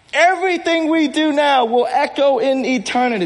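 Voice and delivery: high-pitched rallying declaration in one sweep; Maximus. Acted. Pastor delivers the whole film line to congregation, heroic Maximus. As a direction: proclaim it like a commander before battle. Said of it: "Maximus says".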